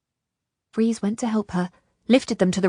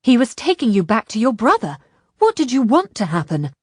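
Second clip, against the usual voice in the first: loud, heated challenge; forceful, indignant raised voice; angry shout; 3 semitones higher, 7 dB louder than usual. A narrator's reading. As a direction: throw it at her angrily. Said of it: "Ishrak shouted at her angrily".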